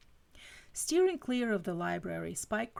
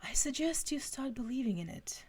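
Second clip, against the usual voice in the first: put-on higher-pitched British accent; Giles's British accent; 6 semitones higher than usual. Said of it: "I read that in Giles's accent".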